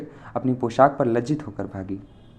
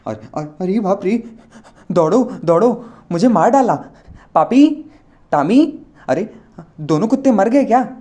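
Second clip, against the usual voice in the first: high, loud panicked cry for help; scared screaming; 8 semitones higher, 6 dB louder than usual. A narrator's reading. Old man screams for help, high frightened shrieks. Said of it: "उसने डर के मारे चीखना शुरू किया".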